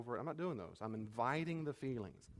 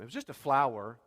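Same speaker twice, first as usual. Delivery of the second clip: dismissive brush-off; 8 dB louder than usual. Retold or imitated. Acted discounting a child.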